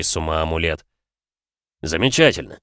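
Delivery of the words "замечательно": sarcastic. Acted. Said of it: "не старался скрыть сарказм".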